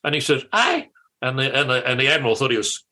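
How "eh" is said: sharp, high puzzled "Eh?"; a startled, incredulous grunt; baffled eh. Retold or imitated. Interviewee mimics Muldoon's baffled reaction, high and sharp.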